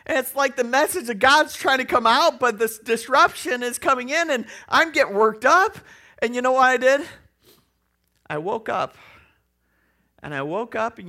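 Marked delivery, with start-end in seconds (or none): 0.0-7.1 louder, higher rising excitement; worked-up storyteller climbing louder; building up. Real, not acted. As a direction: build the tension toward the twist. Getting worked up in retelling.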